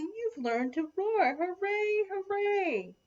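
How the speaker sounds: high, jubilant cheer; proud cheering. A narrator's reading. Brothers and sisters praise Little Lion, proud high cheering. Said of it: "said proudly".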